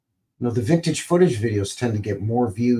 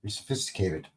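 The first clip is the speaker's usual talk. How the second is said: ordinary talk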